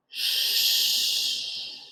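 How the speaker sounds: whispered, soft "shh"; wind shh. A narrator's reading. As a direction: hush like wind in leaves.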